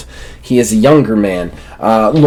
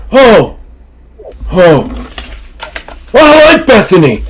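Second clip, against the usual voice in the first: put-on much higher, louder quavering old man; confused old man; 9 semitones higher, 4 dB louder than usual. Old Carl asking who Bethany is, high and loud. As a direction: squawk it out hard of hearing. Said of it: "He goes".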